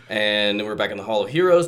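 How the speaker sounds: ordinary delivery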